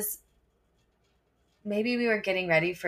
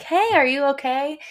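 higher, louder concerned call to a student; 8 semitones higher, 6 dB louder than usual. Retold or imitated. Teachers calling to an upset student, concerned and raised. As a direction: call out to her, worried.

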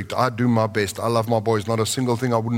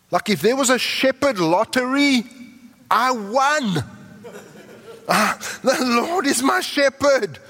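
jubilant exclamation; 13 semitones higher than usual. Acted. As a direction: crow it like you just won the lottery. Preacher exults over shepherd lottery win.

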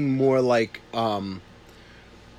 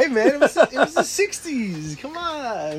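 squeaky it-was-the-sixties whine; high mock protest; 11 semitones higher than usual. Mock exasperated excuse, sixties.

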